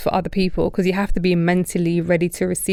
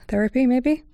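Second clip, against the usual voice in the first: retold doctor's tentative, higher-pitched question; tentative suggestion; 6 semitones higher than usual. Doctor floating therapy to a crying patient, tentative.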